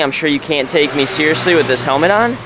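ordinary delivery

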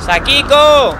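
loud high call across the house. Real, not acted. Searching the dark house, calling loudly for Sakiko. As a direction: shout the name to find her.

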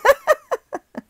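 bright burst of giggling; high laugh. Real, not acted. Vlogger laughing at her journal idea. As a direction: laugh out at yourself.

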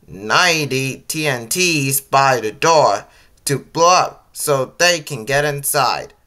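ordinary delivery, nothing marked

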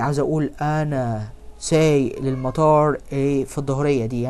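normal talking voice

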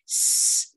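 S as a voiceless hiss, mouth taut; hissed S sound. Shown as a demonstration. Teacher demonstrates the S sound, tense hiss. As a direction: push air out on a bare S. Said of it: "Âm sờ là âm căng miệng".

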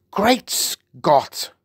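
high royal exclamation; shocked cry. A narrator's reading. The Queen sees the headlines and cries out, shocked. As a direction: exclaim in astonishment. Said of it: "cried the famous voice".

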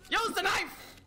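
high, frantic game yell; high-pitched urgent shout. Real, not acted. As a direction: yell the order in a combat panic.

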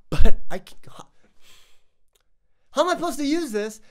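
breaks off in a whisper; a frustrated whisper that cuts out mid-word; whispered frustration. Real, not acted. Reviewer stuck using a gimbal left-handed, whispering.

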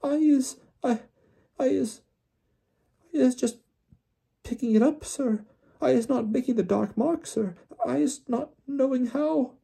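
very high, tearful elf voice; squeaky, halting frightened denial; Winky squealing. A narrator's reading. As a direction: stammer pleas in a tiny squeak. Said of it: "squealed Winky".

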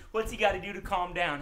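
ordinary delivery, nothing marked

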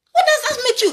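"What" hit high and loud. Real, not acted. Preacher challenging his critics, loud and high. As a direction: throw the question at them hard.

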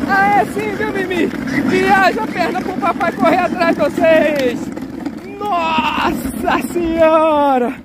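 high, delighted whoops as the car rolls down; excited cheering. Real, not acted. Dad cheering his daughters racing downhill, excited high whoops.

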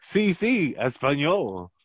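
high, loud, hammed-up Spanish; joking with a friend in fake Spanish; mock Spanish. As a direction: belt it like a cartoon Spaniard.

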